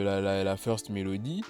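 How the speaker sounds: ordinary talk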